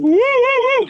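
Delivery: high, loud falsetto whooping; football stadium cheer. Acted. Teacher contrasts the real fan's way, falsetto cheer. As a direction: whoop it way up in head voice. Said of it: "in a football stadium and you go".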